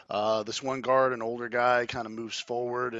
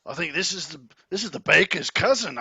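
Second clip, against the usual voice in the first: guard recognizing; 6 semitones higher, 8 dB louder than usual. Acted. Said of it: "he says".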